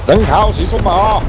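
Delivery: high, loud mocking question; incredulous high exclamation. Real, not acted. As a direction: squeal it out in disbelief. Mocking the accent attempt.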